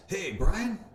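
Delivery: high, loud cheerful greeting; friendly caller voice. Retold or imitated. Voicing Lee's greeting on phone.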